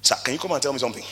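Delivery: hushed and quick, a discreet word; quiet request. Retold or imitated. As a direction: usher slipping a private message to the preacher, quick hush. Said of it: "he told me something quietly".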